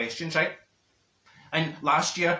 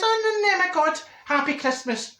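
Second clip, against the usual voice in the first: exasperated outburst; 13 semitones higher than usual. Retold or imitated. Woman reacts to her filling pulled out by toffee, exasperated. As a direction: exclaim it high, in mock dismay.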